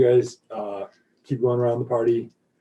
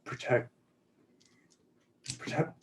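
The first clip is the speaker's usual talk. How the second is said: ordinary talk, nothing marked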